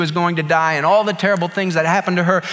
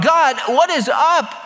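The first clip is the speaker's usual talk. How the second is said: higher, slow and pleading; pleading cry; 9 semitones higher than usual. Retold or imitated. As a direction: beg God for an answer, drawn out. Job crying out to God.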